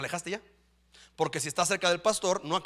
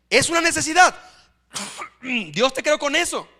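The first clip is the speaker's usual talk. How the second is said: preaching shout; 7 semitones higher, 6 dB louder than usual. Real, not acted. Preacher drives point home.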